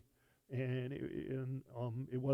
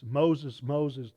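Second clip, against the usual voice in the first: calling Moses, louder, falling on each name; emphatic call; 8 dB louder than usual. Retold or imitated. God calling Moses from the bush, emphatic and louder. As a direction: call the name out twice with weight. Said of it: "it's, it's emphatic".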